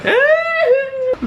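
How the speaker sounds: real delighted laugh-cry; giddy squealing laugh. Real, not acted. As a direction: burst into a squeal of joy. Overjoyed at Mikey's reply.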